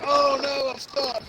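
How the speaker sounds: a touch of a mock panicked victim; mock helpless actor. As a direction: play the stuck guy, over the top. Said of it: "he's like".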